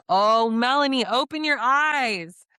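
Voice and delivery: loud, high, drawn-out wail of exasperation; exasperated plea. Retold or imitated. Viewer begging a contestant to open their eyes, exasperated. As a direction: cry out as if to wake them up.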